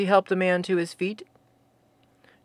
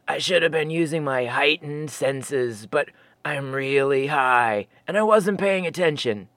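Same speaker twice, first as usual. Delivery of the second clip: stoned Sasquatch; 5 semitones lower than usual. Acted. Fosby admits he's high.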